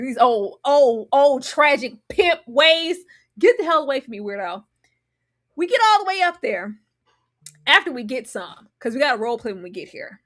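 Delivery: ordinary delivery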